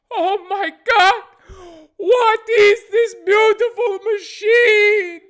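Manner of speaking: high, loud, slow amazed Italian caricature; wailing mock-Italian astonishment; mock Italian accent. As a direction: cry out in wonder with a hammed-up Italian accent. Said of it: "I made 'em sound Italian".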